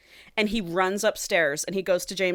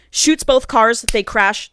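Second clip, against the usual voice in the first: ordinary talk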